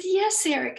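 high, loud delighted reply; thrilled yes; joyful exclaim. Retold or imitated. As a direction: beam it out to the son. Mother answering son joyfully.

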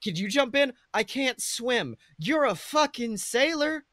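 normal talking voice

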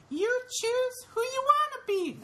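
put-on very high, slow childlike voice; boy urging the giant on; little kid voice. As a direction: say it sweet like a small boy. Boy's voice in giant's head.

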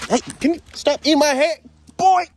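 high loud protest; startled yelp. Real, not acted. To the camel chewing his hair, a high startled protest.

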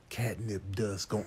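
ordinary talk, nothing marked

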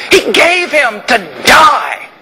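a heavy, drawn-out shout; slow shout. Real, not acted. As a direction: shout it out slowly, every word weighed. Climax of the point.

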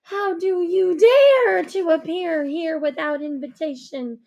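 affronted fairy queen; haughty angry queen. Acted. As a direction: scold an uninvited intruder. The lady's rage at the intruder.

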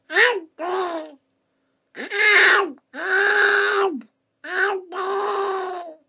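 long, wailing squawks; drawn-out cartoon duck cries; duck crying help. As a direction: call desperately for help like a duck.

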